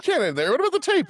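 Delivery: high, loud imitated complaint; mock protest. Listeners demanding the rest of the story, mock protest. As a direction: pitch it up like an indignant heckler.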